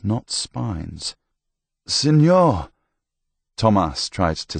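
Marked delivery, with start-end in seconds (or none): 1.9-2.7 high, loud cry to his lord; calling out. A narrator's reading. Wounded Tomas hailing his lord, calling out high and loud. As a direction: call up to your master, voice raised.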